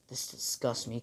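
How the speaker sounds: very soft, subdued revulsion; hushed acted contempt; quiet disgust. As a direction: say it under your breath. Villain Deku looks at his costume.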